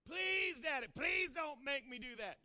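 put-on high, loud pleading child; child begging his daddy, loud and high. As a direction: whine and beg like a small kid.

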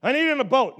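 loud, insistent mock whine; mock panicked demand. Pastor voicing a drowning person who insists on a boat, loud and insistent. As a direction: blurt it like someone drowning.

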